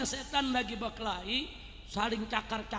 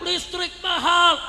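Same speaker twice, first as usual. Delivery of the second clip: higher, drawn-out mock protest cry; imitated men's shout; 7 semitones higher, 9 dB louder, slower than usual. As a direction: call out slowly as the complaining men. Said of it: "Bapak-bapak juga teriak".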